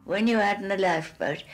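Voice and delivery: normal talking voice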